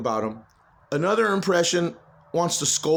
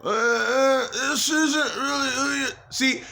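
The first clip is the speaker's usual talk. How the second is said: mildly raised imitation of the voices in his head; imitating the noises; 6 semitones higher, slower than usual. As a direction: lightly voice the intruding noise. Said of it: "the noises that you guys can hear".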